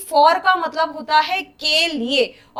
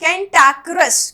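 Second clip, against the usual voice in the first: slow pronunciation; slower than usual. Shown as a demonstration. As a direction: say कैंटाक्रस slowly, syllable by syllable. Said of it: "इसे pronounce धीरे धीरे करें".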